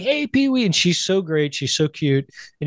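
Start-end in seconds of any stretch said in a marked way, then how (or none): 0.0-0.6 much higher sing-song greeting; imitating Whoopi. Retelling Whoopi greeting Pee-wee, a high bubbly mock voice. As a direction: chirp the hello like a bubbly guest. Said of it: "she's like".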